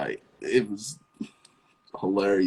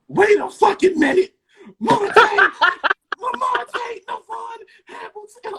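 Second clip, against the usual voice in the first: high screaming laugh, calling his full name; laughing shriek; 8 dB louder, slower than usual. Retold or imitated. Mom shrieking at her son through laughter. Mom discovers onesie, yells.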